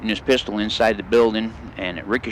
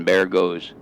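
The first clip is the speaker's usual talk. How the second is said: ordinary talk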